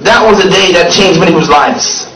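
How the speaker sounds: usual speaking voice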